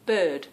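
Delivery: "bird" with a long vowel and no R; own pronunciation. Shown as a demonstration. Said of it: "they do laugh at when I say it".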